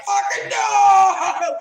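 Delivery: slow, high, laughing shout; shocked delight. Retold or imitated. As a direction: shriek with disbelieving joy. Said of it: "and I'm like".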